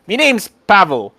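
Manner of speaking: high-pitched little halfling voice; excited halfling. Acted. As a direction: introduce yourself as an eager small character. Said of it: "He's very excited to see".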